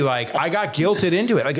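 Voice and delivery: normal talking voice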